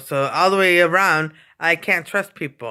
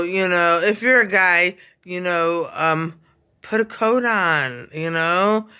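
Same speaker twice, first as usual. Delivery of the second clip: usual speaking voice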